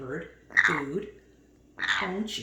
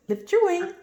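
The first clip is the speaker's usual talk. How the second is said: high, loud cue with YOUR lifted; sing-song command; 11 semitones higher, 7 dB louder than usual. Real, not acted. Owner cueing her parrot, high bright sing-song. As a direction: chirp the cue up high so the bird lifts. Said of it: "training command to parrot".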